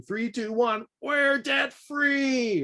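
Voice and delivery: high, drawn-out countdown cheer at normal volume; mock scream. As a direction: imitate a triumphant scream without full volume. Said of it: "they will finish by screaming".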